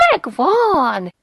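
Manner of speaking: loud, high cry of discovery; excited shout. A narrator's reading. Mother finding a coin in a pocket, excited shout. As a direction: shout it out, stretched with triumph. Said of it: "kiáltotta".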